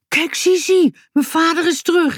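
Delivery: put-on child calling out the happy news; calling out excitedly. A narrator's reading. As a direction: announce it with delight. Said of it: "riep Boutje".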